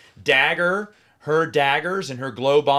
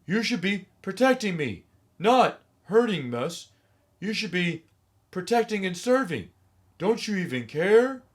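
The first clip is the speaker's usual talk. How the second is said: lightly raised, stiff fake outrage; mock wooden anger; 5 semitones higher than usual. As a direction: play angry but hollow, like a bad actor. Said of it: "when he gets mad at a policeman".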